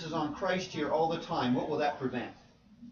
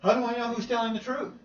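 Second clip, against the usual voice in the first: puzzled listener; 8 semitones higher, 4 dB louder than usual. Retold or imitated. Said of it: "Audience going".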